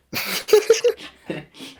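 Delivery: high squealing burst of laughter; shrieking laugh. Real, not acted. Reacts to cousin question.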